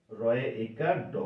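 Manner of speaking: low, slow spelling of "রয়ে একার ড"; spelling out the sound. Shown as a demonstration. Teacher spelling the past form's sound, low and slow "রয়ে একার ড". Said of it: "আর এটার উচ্চারণ হচ্ছে".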